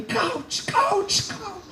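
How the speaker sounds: very high mock calling, fading on the last word; squeaky pursuing voice, coach repeated; imitating her calls. Official trotting beside him, calling in a squeaky, very high voice. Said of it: "So she's yelling".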